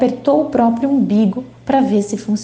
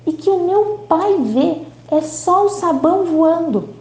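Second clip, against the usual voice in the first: ordinary delivery, nothing marked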